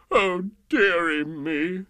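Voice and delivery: slow, mock-sobbing lament. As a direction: wail it like a sulky king. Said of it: "The King sobbed".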